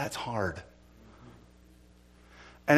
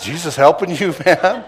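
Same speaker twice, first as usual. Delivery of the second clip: loud mocking thug voice; 5 semitones higher, 11 dB louder than usual. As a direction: jeer like a street tough. Imitating mocking bad guy.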